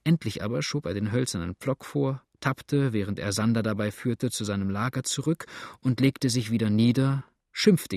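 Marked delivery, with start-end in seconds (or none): none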